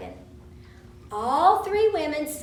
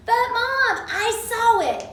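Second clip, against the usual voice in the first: put-on insistent child; 9 semitones higher, 5 dB louder than usual.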